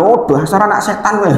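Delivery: high exasperated scolding; mock scolding mother. Mother scolding child who spilled the food, mock angry. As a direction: snap at the naughty kid. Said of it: "Biasanya kayak gitu kan".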